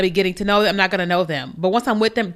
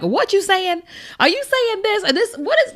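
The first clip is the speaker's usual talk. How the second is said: high, puzzled questioning voice, much higher; squeaky bewildered asking, pitched way up; confused guesser; 11 semitones higher than usual. Retold or imitated. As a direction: play someone lost trying to decode a message. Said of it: "guesswork of, like".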